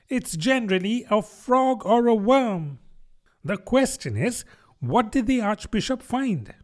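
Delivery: high-pitched duck character; argumentative duck. A narrator's reading. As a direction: argue back in a high voice. Duck presses its question.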